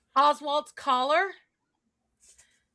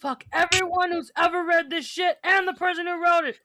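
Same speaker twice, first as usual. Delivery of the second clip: mock rage; 6 semitones higher, 7 dB louder than usual. Mock furious outburst.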